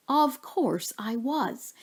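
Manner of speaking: ordinary delivery, nothing marked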